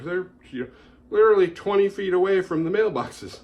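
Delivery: lazy mailman. Retold or imitated. Ranting about a random mailman, in a high careless voice. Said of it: "What's up with this random mailman".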